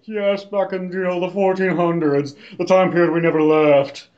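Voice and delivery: lightly put-on snooty noble voice; mock aristocrat. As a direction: play a stuffy old-world aristocrat, half joking. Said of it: "they're all, like, aristocratic-y".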